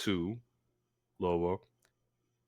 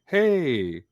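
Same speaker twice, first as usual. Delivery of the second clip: cheery manager voice; 8 semitones higher, 4 dB louder, slower than usual. Retold or imitated. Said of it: "My manager's like".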